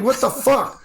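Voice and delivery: high, loud disbelieving outburst; exasperated outcry. Retold or imitated. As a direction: throw up your hands in disbelief. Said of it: "I'm like".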